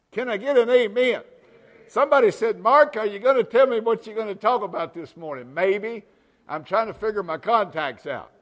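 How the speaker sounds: loud, high pulpit call to the congregation; preaching at full force. Acted. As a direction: rouse the room.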